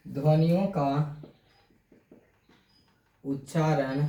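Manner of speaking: slow dictation, phrase by phrase with long pauses. Shown as a demonstration. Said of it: "यहाँ से लिखते हैं हम लोग".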